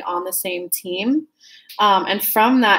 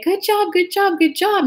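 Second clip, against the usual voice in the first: high, bright-eyed cheerleading; cheery praise; 9 semitones higher than usual. Pretend. Partner cheering them on every day, high and gushing. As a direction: gush it like a proud cheerleader.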